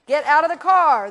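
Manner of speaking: commanding shout. Shown as a demonstration. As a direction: throw the fear out loudly. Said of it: "tell them".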